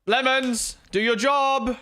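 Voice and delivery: loud, high-pitched yell at the mod; calling out. Real, not acted. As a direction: holler an order at a slacking helper. Calling out his slacking chat moderator.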